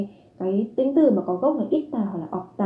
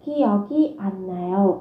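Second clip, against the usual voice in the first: Kiyoki an nayo drawn out very slowly, syllable by syllable; slow syllable demo; much slower than usual. Shown as a demonstration. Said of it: "an mang nghĩa là không".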